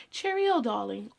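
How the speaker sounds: tossing off a cheery posh British goodbye in a British accent. Shown as a demonstration. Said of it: "I'll drop a British accent all the time".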